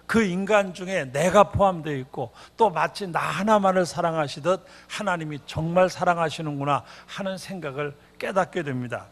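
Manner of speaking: ordinary talk, nothing marked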